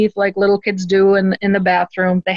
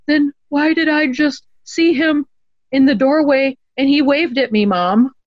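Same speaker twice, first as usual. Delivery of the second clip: put-on high, small three-year-old voice; retold child's high-pitched question; shocked little girl; 7 semitones higher than usual. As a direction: imitate a bewildered tiny girl asking mom. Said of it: "she looked even more shocked".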